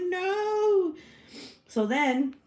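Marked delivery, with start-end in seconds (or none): 0.0-0.9 very high drawn-out "no"; drama-queen wail, hammed-up; dramatic refusal. Retold refusal to calm down.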